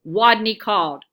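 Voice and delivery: ordinary delivery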